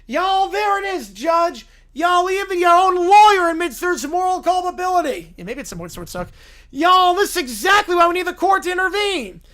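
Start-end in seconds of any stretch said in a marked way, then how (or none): 0.1-5.3 loud, pompous Southern lawyer voice. Acted. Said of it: "Southern blue-haired lawyer". 6.7-9.4 loud, pompous Southern lawyer voice. Acted. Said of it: "Southern blue-haired lawyer".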